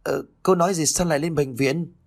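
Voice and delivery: startled, pitched well up; a sudden confused question; surprised. A narrator's reading. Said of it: "bà ngạc nhiên". (ngạc nhiên, giọng cao hẳn lên)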